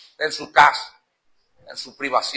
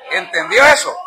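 shout; 5 dB louder than usual. Real, not acted. Preacher shouting at congregation.

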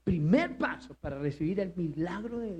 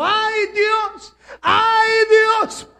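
high, loud crying shout; terrified cry; 13 semitones higher, 12 dB louder, much slower than usual. Retold or imitated. Teacher feeling death, crying out to God. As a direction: wail it out as death approaches. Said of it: "cuando sintió la muerte, dijo".

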